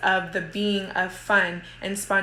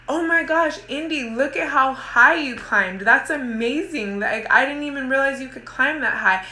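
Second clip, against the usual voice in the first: much higher, bright-eyed amazement; playful excitement; 6 semitones higher, 3 dB louder than usual. Shown as a demonstration. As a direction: praise the climbing child, delighted. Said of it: "playfully grab her instead of in a panic".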